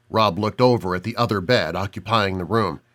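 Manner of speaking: ordinary delivery, nothing marked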